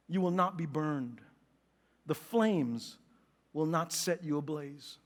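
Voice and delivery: low, soft, measured reading; scripture reading. A narrator's reading. Preacher reads Isaiah's comfort to congregation, low solemn reading. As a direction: read it solemnly, pausing between phrases.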